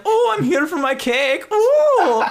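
very high, loud, gleeful yell; excited yell. Acted. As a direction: shout with excitement for cake. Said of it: "sprints out yelling".

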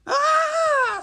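high, loud scream; terrified scream. Acted. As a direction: scream out as a frightened woman.

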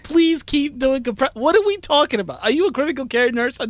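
incredulous. Real, not acted. Incredulous outburst at husband.

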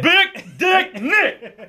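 high, very slow, laughing Denzel-style delivery. Retold or imitated. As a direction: savor every word like a movie star. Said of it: "I gotta say it like I'm Denzel".

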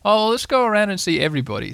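eager bear making a suggestion; Pooh. A narrator's reading. Bear rallies Piglet for visits, high eager character voice. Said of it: "said Pooh".

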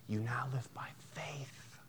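soft, hushed; whispered emphasis. Real, not acted. Preacher to congregation, hushed whisper. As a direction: drop to a whisper for weight.

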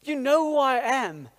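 milder retold plea, pulling rank on a friend; retold protest. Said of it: "I'm like".

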